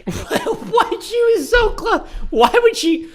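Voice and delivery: high, drawn-out laughing outburst; laughing disbelief. Real, not acted. Reactor losing it at her near miss, laughing disbelief. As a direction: crack up while protesting, pitch way up.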